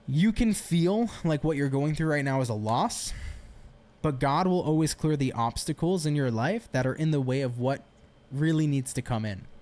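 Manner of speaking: usual speaking voice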